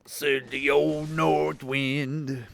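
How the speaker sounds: slow, drawn-out spooky voice; eerie voice. Pretend. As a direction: intone it slowly like a ghost. Echoing eerie voice.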